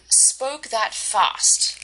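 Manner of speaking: "spoke that fast" said very slowly, each word weighted; stressed spoke that fast. Shown as a demonstration. Teacher demonstrating the girl's stress, slow and deliberate. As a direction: drag out "spoke that fast", leaning on "fast". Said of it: "She emphasized the stress on".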